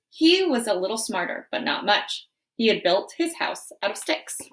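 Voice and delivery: wolf narrator. Acted. Wolf narrates his story.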